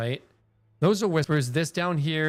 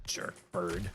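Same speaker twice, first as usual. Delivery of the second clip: muttered curse; 8 semitones lower, 15 dB quieter, slower than usual. Real, not acted. Cursing an in-game bird.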